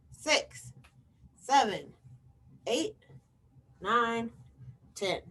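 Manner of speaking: ordinary delivery, nothing marked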